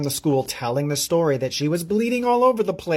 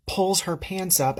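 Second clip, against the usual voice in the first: plain reading voice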